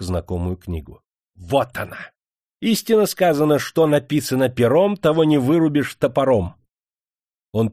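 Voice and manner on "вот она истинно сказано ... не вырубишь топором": high, loud gloating; triumphant. A narrator's reading. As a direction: crow in triumph. Interrogator triumphs with the book.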